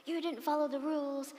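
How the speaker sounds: put-on loud, high, accusing child's yell; a child's heated playground shout; angry shout. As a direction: shout it at the other kid like a ref calling a foul. Retold quarrel with a child.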